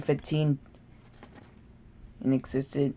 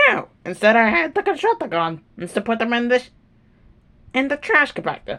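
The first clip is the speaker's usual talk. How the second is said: high whiny confession; 7 semitones higher, faster than usual. Acted. Subordinate confessing, crying out.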